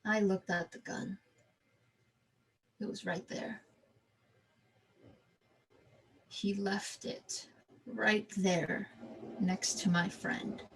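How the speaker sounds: slow and hushed; quiet. Retold or imitated. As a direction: linger on each word softly. Staring at the gun, hushed.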